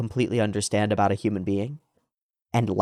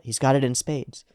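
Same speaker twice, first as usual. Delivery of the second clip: usual speaking voice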